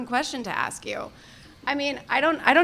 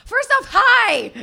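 excited shout; 8 semitones higher, 5 dB louder, slower than usual. Real, not acted. Greeting the live crowd.

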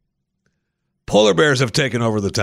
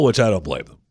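ordinary delivery

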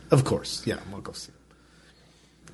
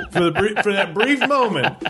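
higher, talking through laughter; laughing; 9 semitones higher than usual. Real, not acted. Amused at his own admission, laughing. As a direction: laugh your way through the words.

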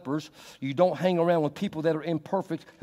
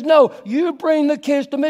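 raised, firm call; Jesus insisting; 11 semitones higher, 8 dB louder than usual. Retold or imitated. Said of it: "And Jesus says".

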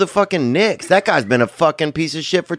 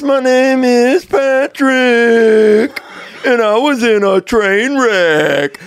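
higher, drawn-out goofy greeting; slow cheerful oddball introducing himself; Patrick character voice; 6 semitones higher, 4 dB louder, much slower than usual. Acted. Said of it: "Let me do your character".

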